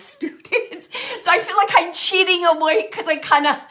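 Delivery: high, loud, laughing it out; giggling sheepishly. Real, not acted. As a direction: laugh through an embarrassed confession. Laughing about cheating with student.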